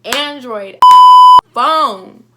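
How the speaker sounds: loud, high, slow outburst; outraged. Real, not acted. Telling viewers about the phone smack, loud outraged outburst. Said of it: "I was so mad".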